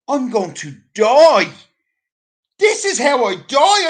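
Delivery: hammed-up drowning panic; mock panic. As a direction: belt out the realisation of doom. Retelling panic while drowning.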